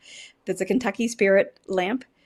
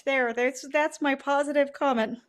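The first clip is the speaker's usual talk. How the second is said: ordinary talk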